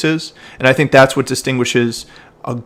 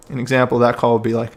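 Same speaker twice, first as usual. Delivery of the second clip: ordinary talk, nothing marked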